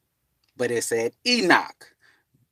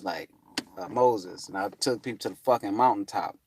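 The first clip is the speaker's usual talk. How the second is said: ordinary talk, nothing marked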